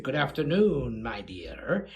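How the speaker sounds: low, courtly greeting, fading soft; charming Lugosi. Retold or imitated. Actor greets the photographer, low soft charm. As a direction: lightly imitate a suave gentleman, dropping to a hush. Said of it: "he's very charming".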